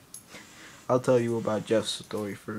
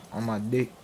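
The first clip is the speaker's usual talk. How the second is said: normal talking voice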